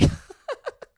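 sudden squealing laugh; laughing high and loud; high burst of laughter. Real, not acted. Comedian cracking up at her own fear.

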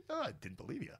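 high, light voice for the diplomat; a playful high-pitched spy friend; amused friend. Retold or imitated. Spy friend's retold reply.

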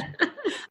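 high squeaky giggle; burst of laughter. Real, not acted. Laughing at Caitlin's silent laughter.

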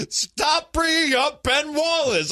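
high-pitched hammed-up scream, dragged out; mock screaming. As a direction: shriek it slowly in exasperated imitation. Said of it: "was just screaming".

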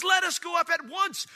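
high, loud battle call; urgent rallying shout. Acted. Caleb urging the people to obey God, urgent shout. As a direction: call them to move without hesitation. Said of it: "No hesitation in obeying God".

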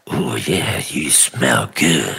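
low, sultry pretend seduction; phone sex voice. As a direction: croon it down the line, slowing on the last words. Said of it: "a phone sex thing".

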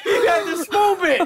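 squealing laughter over the words; laughing. Real, not acted. Laughing at chili reactions.

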